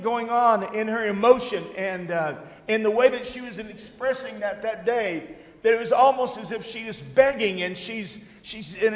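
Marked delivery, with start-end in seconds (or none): none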